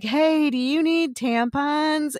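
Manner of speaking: high, slow, drawn-out call across aisles; retold yelling. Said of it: "my sister-in-law yelled".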